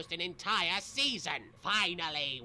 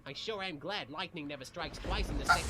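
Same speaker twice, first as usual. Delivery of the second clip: ordinary talk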